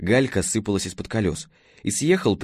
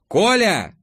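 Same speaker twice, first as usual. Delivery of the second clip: громко зовёт, высоко и с напором; крикни имя через лестницу; громкий окрик; 11 semitones higher, 5 dB louder than usual. A narrator's reading. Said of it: "грянула Алёна". (громкий окрик Коле)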